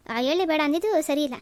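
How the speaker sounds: normal talking voice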